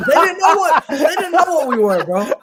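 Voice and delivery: high, loud, laughing protest; laughing exasperation. Real, not acted. Guest recalling being mistaken for African, high laughing protest. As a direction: cry it out in disbelief through laughter.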